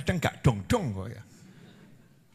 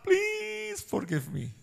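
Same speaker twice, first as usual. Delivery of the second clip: high pleading; 7 dB quieter, much slower than usual. Pretend. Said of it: "seharusnya kita".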